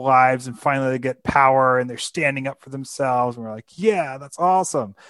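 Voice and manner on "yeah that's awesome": higher, louder burst of approval; enthusiastic cheer. Acted. Audience cheering the hero who finally gets power, excited. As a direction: cheer on the underdog.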